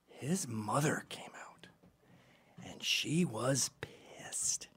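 slow, hushed storyteller whisper; whispering the ominous part of the story. Real, not acted. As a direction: lean in and whisper it slowly. Hushed dramatic retelling.

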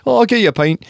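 high, loud mock Glaswegian pub voice; Glaswegian pub regular. Pub regular offering pints, loud mock Glaswegian. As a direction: push a pint on him in a hammed-up Glaswegian accent. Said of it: "like a Glaswegian pub".

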